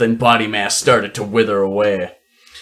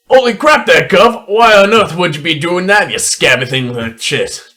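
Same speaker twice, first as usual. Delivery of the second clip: high, loud outraged dwarf; angry dwarf voice; 7 semitones higher, 5 dB louder than usual. Acted. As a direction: squawk it out as an indignant dwarf.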